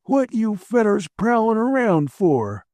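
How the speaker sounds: put-on high, slow old codger voice; a suspicious old man at his door. A narrator's reading. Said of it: "an old man came to the door".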